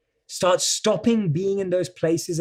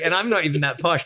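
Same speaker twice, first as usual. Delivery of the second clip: normal talking voice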